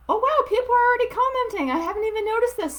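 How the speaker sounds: suddenly spotting the comments, thrilled; surprised. Real, not acted. Surprised to see comments already.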